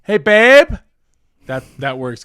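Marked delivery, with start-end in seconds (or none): 0.0-0.7 high, loud sing-song Hey, babe with a rising end; a playful loud high babe greeting. Shown as a demonstration. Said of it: "That's how I say it".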